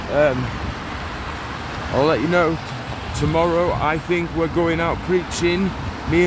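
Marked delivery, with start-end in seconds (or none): none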